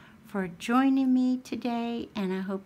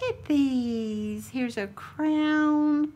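slow, loud show-and-tell; loud slow happy reveal; delighted reveal; 5 semitones higher, 5 dB louder, much slower than usual. Real, not acted. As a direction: present it proudly, slowly. Showing gift items.

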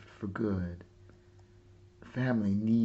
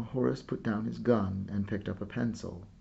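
ordinary delivery, nothing marked

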